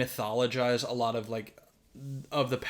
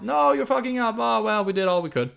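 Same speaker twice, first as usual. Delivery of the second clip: much higher, louder nagging grown-ups; fussy adults; 12 semitones higher, 4 dB louder than usual. Retold or imitated. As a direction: scold half-heartedly from afar. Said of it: "all of the adults are like".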